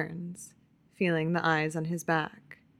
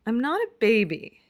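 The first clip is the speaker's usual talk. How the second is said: pouting; 5 semitones higher, 5 dB louder, faster than usual. A narrator's reading. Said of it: "Keeley says with a pout".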